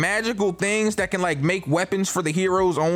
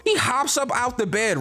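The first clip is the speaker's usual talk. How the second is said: ordinary talk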